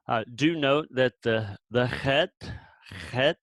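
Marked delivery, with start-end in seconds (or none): 2.0-3.4 "chet" opening on a throaty rasped ch, as in German "Bach"; guttural ch. Shown as a demonstration. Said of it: "is pronounced like ch".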